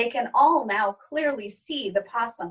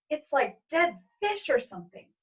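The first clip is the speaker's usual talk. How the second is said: higher, mildly disgusted mother; mother's voice; 6 semitones higher than usual. Acted. Mother agreeing about the smell, higher and disgusted. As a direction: wrinkle your nose at it.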